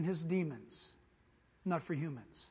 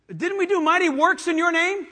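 higher, loud indignant plea; loud pleading; 8 semitones higher, 12 dB louder than usual. Acted. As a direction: protest desperately at the gate. Pleaders at judgment.

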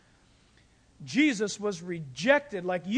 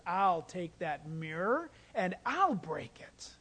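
low, slow, hushed promise; tender whisper; 5 semitones lower, 4 dB quieter, slower than usual. Retold or imitated. Christ promising the sinner, slow and hushed. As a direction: soften into a whisper.

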